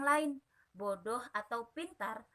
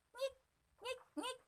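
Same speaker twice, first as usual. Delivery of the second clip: very high, thin squeaking imitation; mock the wheezing cat; tiny mocking wheeze; wheezy cat squeak; 13 semitones higher, 8 dB quieter than usual.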